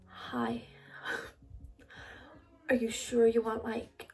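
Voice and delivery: hushed, American-sounding attempt at an English man; botched American-sounding accent. Sugar daddy's first phone hello, in accidental American accent. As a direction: put on a soft, breath-heavy, accidental American accent. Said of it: "why is that American?".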